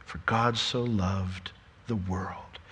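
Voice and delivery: slow, low, soft verse; hushed reverence. Acted. Preacher returning to the verse about everyone, hushed and slow. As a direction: say it softly, giving every word time.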